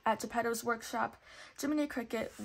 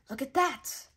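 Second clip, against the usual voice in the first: exclaiming; 4 semitones higher, 4 dB louder than usual. A narrator's reading. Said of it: "the fox exclaimed".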